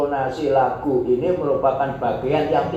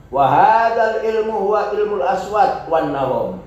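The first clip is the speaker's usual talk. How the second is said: raised, louder recitation of the Arabic; Arabic text reading; 7 semitones higher, 4 dB louder than usual. Acted. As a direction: read the Arabic passage out formally. Reads Arabic text aloud.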